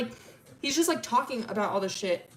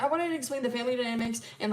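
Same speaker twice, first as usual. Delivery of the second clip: fast, higher-pitched explaining; frantic rambling; 4 semitones higher, faster than usual. Retold or imitated. Milo going crazy explaining family dynamics, fast rambling. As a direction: rush it out in one go. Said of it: "he's not talking normally".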